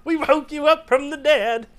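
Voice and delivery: high, slow comic explanation; hammed-up cartoon reassurance; high cartoon superhero voice. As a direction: drag it out in a silly squeal. Voicing a hero answering Superman.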